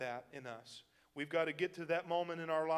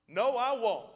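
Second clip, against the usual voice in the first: loud, higher stubborn "No, I won't."; emotional refusal; 6 semitones higher, 8 dB louder than usual. Retold or imitated. Preacher voicing a stubborn believer's loud refusal. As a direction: dig in and refuse with feeling. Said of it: "how emotional you are".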